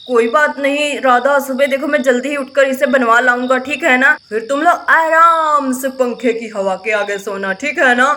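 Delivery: calm him down-low, promise to fix it; deep soothing husband voice; husband reassuring. Acted.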